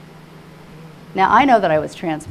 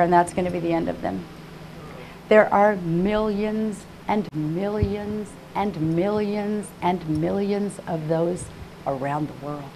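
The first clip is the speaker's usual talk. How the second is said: ordinary talk, nothing marked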